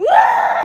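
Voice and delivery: very loud panicked scream; losing it. Retold or imitated. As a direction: re-enact a sudden labor scream. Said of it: "I lost it".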